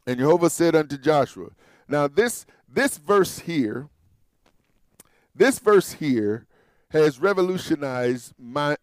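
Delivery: normal talking voice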